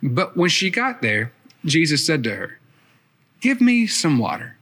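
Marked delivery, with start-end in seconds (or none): 3.4-4.6 a curt request with no please, pitched higher; just ask, don't bother being polite; blunt demand. Retold or imitated. Jesus asking the woman at the well, blunt and curt. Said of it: "kind of rude that Jesus didn't say".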